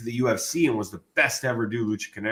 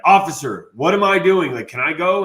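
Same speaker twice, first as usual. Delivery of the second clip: louder, higher appeal to an officer; exasperated pleading; 7 semitones higher, 6 dB louder than usual. Retold or imitated. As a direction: call out like a baffled detainee. Retold appeal to jail officer.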